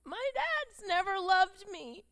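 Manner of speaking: high, loud, slow tearful plea; tearful crying. Retold or imitated. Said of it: "like, cries".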